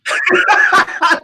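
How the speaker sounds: high loud laugh; bright cackle; burst of laughter. Real, not acted. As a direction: laugh out at the answer. Laughs at pineapple answer.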